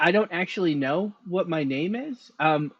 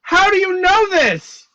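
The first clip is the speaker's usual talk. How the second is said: high, loud, slow demand; alarmed shout; 8 dB louder, slower than usual. Acted. Character confronts mysterious fortune teller.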